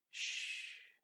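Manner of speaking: hushing. Acted. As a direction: hush a crying child. Mare hushes crying pony.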